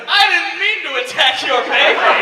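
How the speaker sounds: mock apology cracking into a laugh. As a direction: protest while trying not to laugh. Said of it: "impossible to say without laughing".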